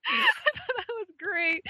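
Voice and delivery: high, slow words still shaking with laughter; laughing. Real, not acted. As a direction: talk through the last of the giggles. Still laughing after the quote.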